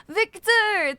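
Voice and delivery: gasp, then a high, loud name; surprised gasp. Real, not acted. Spotting a viewer's streak, a gasping high thank-you. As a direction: catch your breath at the surprise.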